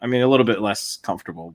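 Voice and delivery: normal talking voice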